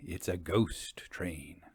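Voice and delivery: hushed, slow whisper on the reveal; spooky whisper. Acted. Host hushing listeners into a ghost train reveal, whispered. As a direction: breathe it out like a secret.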